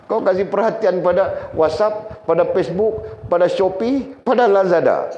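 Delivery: rising exasperated list, scolding a distracted wife. Scolding wife for phone habits.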